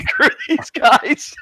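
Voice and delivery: a laughing, drawn-out mock brush-off; mock fed-up quitter. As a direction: play him as fed up and done with everyone. Friend voiced quitting in disgust.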